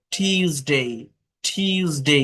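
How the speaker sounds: "টিউসডে" as tyooz-dei, puffed t; careful "টিউসডে" with y glide after t; correct Tuesday. Shown as a demonstration. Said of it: "টুয়েসডে না".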